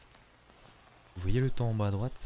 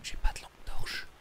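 hushed fearful whisper; 8 dB quieter than usual. Real, not acted. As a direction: breathe the words, no voice. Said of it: "Je peux pas parler plus fort".

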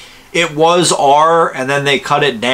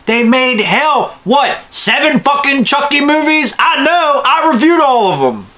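excited; 9 semitones higher than usual. Real, not acted. Excited rant about Chucky.

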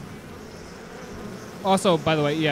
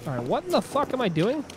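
exasperated; 6 semitones higher, 4 dB quieter than usual. Real, not acted. Confused by game situation.

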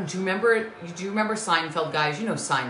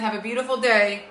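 normal talking voice

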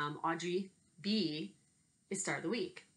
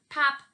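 pop; 9 semitones higher, 11 dB louder than usual. Acted. Balloon pops in story.

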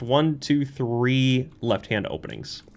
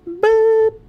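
playful squeak; 9 dB louder than usual. Pretend. Playful noise while jumping.

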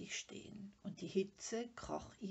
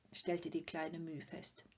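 plain reading voice

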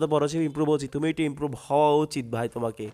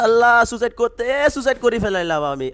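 dismayed shock; 7 semitones higher, 4 dB louder than usual. Real, not acted. Accidental suicide in game.

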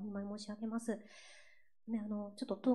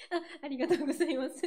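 high, louder thanks through laughter; laughing thanks; 7 semitones higher, 5 dB louder, much faster than usual. Real, not acted. Singer thanks the viewers for their comments, laughing. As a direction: thank them quickly while giggling.

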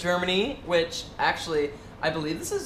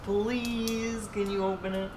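drawn-out, wheedling plea; playful begging; 3 semitones higher, 4 dB quieter, slower than usual. Pretend. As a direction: beg sweetly, stretching the please. Begging partner to open package.

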